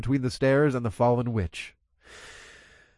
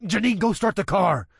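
shouted command; 7 semitones higher, 3 dB louder than usual. Acted. Shouting orders in escape.

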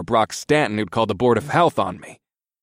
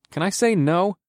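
higher, hesitant question; asked slowly; 9 semitones higher than usual. A narrator's reading. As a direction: ask it carefully, a little slowly. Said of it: "I asked slowly".

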